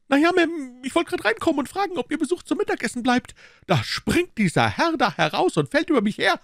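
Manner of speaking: very high, fast, aggrieved maid; indignant maid. Acted. Maid complaining to her mistress, very high and fast. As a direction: rattle off the complaint, put upon.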